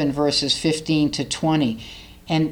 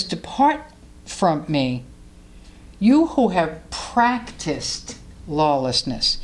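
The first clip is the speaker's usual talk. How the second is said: slow, weighty "you who have practiced lawlessness"; solemn judgment; 3 semitones lower, much slower than usual. Retold or imitated. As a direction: draw out "lawlessness" sternly. Jesus' judgment quoted.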